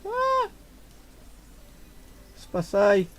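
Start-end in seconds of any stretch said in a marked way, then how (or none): 0.0-0.5 startled shriek. Real, not acted. Scared by monster.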